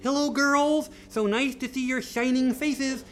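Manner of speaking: high, sing-song welcome; cheerful mommy. Acted. Mommy welcoming the girls home to supper, high and cheery.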